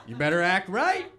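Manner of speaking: loud, high, scolding the crowd; mock threat. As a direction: warn them loudly, half joking. Comic mock-threatens the audience.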